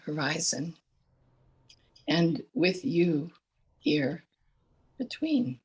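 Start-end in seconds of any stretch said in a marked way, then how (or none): none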